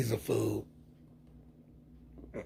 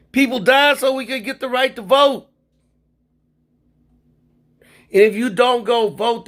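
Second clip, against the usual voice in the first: high, loud, fired-up protest; raised voice in real indignation; heated outburst; 9 semitones higher, 7 dB louder than usual. Real, not acted. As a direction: let the anger about voting burst out. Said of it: "sometimes I get really emotional and get mad".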